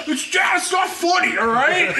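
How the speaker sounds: hammed-up high-pitched whine; mock tearful protest. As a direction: imitate a crying child protesting to dad. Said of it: "so embarrassed and I'm crying".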